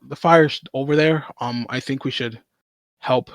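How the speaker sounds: ordinary talk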